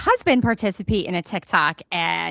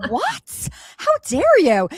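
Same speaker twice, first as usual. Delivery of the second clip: high, then louder, hammed-up indignation; mock outrage; 9 semitones higher, 5 dB louder, much slower than usual. Wife to reluctant husband, hammed-up mock outrage. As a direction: play up being insulted, much higher.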